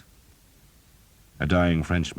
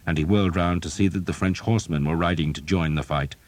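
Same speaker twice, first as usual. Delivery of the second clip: ordinary delivery, nothing marked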